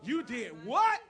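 loud, high astonished question; incredulous reaction. Acted. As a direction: blurt it out in loud disbelief. Saul reacts to lion story.

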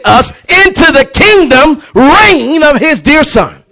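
loud, high shouting sermon; rally the church, hammering every line; fiery pulpit preaching; preaching at full force. Acted.

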